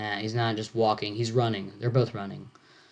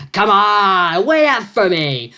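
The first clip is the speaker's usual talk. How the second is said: higher, louder, slow calling-out voice; calling after; 8 semitones higher, 7 dB louder, much slower than usual. Acted. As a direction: shout after someone running ahead. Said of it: "I'm not good at delivering these lines".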